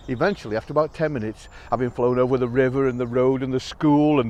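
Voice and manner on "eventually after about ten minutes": ordinary delivery, nothing marked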